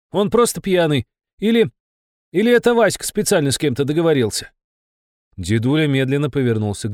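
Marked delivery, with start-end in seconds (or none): 0.1-4.5 high, fast, insistent protest; defiant young activist. Acted. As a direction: argue like a stubborn Komsomol member. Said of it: "не сдавался комсомолец".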